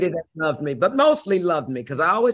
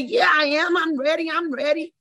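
put-on high, eager child insisting; a five-year-old pleading she's ready; insistent little girl; 10 semitones higher than usual. Answering Miss Vera, high eager child voice.

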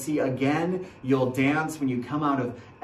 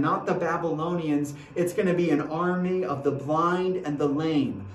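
ordinary delivery

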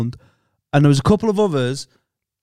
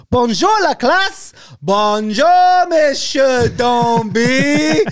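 exaggerated sing-song schoolroom French, stretching every syllable; mock classroom greeting; 9 semitones higher, much slower than usual. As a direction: chant it like a class greeting their French teacher. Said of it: "The start of every lesson".

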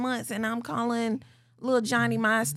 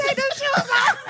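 high, loud laughing order; 6 dB louder than usual. Pretend. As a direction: crack up while scolding. Teasing a drunk clubgoer.

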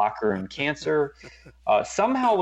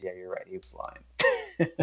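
Sean Payton's reply; 3 semitones lower, 5 dB quieter than usual. Retold or imitated. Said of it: "Sean Payton looks at Vitt and he says".

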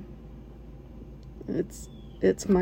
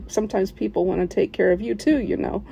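ordinary talk

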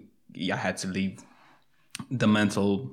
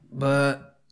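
ordinary talk, nothing marked

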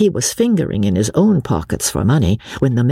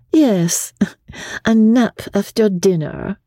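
higher, arch foreign lady; 10 semitones higher than usual. A narrator's reading. Masked lady teases Joss about napping, archly. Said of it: "said the mask archly".

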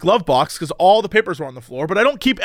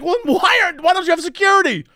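incredulous; 11 semitones higher, faster than usual. Retold or imitated.

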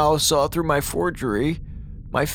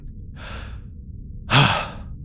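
long sigh; 6 semitones lower, 5 dB quieter than usual. Acted. Weary sigh before the task.

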